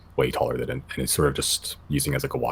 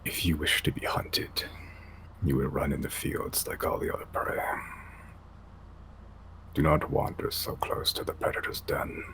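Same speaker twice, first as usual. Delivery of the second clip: guttural Yeti elder; 11 dB quieter than usual. Acted. Yeti elder warns the party.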